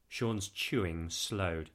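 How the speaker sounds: ordinary delivery, nothing marked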